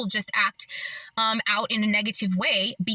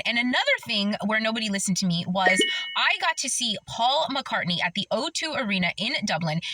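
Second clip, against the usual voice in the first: ordinary talk, nothing marked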